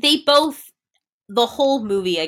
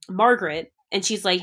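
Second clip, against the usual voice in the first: ordinary talk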